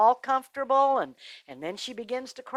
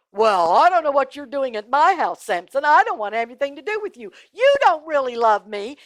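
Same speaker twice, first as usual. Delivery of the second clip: louder, higher scolding; indignant rebuff; 5 semitones higher, 6 dB louder than usual. Acted. Delilah turning Samson away at her door, indignant. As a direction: push him away with loud reproach.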